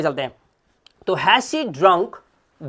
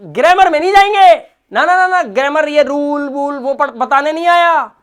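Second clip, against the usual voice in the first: loud, fast, much higher burst; emphatic exclaim; 8 semitones higher, 8 dB louder than usual. Real, not acted. Teacher insisting to students that he won't teach rules, loud and excited. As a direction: wave away the grammar rules with energy.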